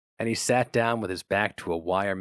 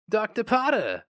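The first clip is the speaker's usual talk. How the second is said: higher, louder eager salesman; 11 semitones higher, 4 dB louder than usual. A narrator's reading. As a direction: greet him like a pushy salesman. Salesman Dunkel greets David.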